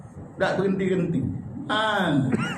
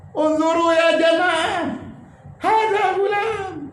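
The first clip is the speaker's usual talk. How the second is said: weep as you call to the congregation; high voice breaking into tears; tearful calling out; 12 semitones higher than usual. Retold or imitated. Imam weeping, calls to congregation.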